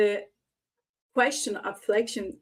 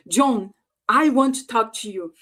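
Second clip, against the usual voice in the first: loud, emphatic demand; forceful voice colour; 7 dB louder than usual. Shown as a demonstration. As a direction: call John out firmly and louder. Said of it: "the voice and the melody has changed".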